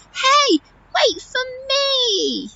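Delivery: high, stretched calling out; shouted call. Acted. Animals chasing elephant into river, high drawn-out shout. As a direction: call high after a friend, trailing long. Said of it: "all shouted".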